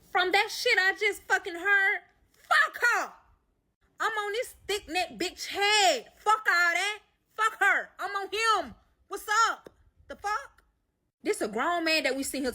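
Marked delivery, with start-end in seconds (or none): 0.1-10.6 loud, high-pitched angry rant; heated outburst. Retold or imitated. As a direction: reenact firing back, pitch way up and insistent. Retold furious retort about confronting him.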